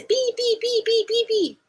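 high-pitched beep imitation; imitated beeping. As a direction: chirp each pi like a firefighter's alarm. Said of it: "Esses bips, fazia".

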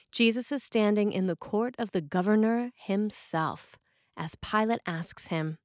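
usual speaking voice

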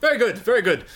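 mock upper-class British accent, pitched high; plummy British toff voice, played for laughs; posh British accent. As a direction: ham up a posh British gent approving. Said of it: "That was my British accent right there".

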